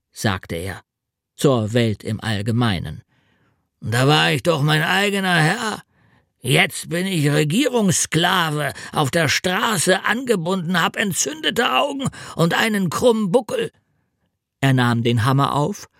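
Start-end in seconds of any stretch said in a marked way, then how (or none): 3.8-13.7 put-on higher, whining old road mender voice; complaining road mender. A narrator's reading. Road mender grumbling to the world, whiny and high. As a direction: moan about being a government slave.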